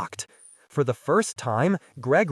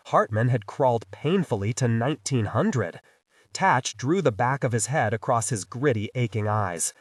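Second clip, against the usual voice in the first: usual speaking voice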